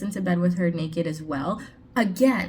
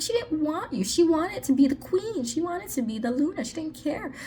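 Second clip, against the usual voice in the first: mock exasperated retort; 7 semitones higher than usual. Acted retort to deluded male lead.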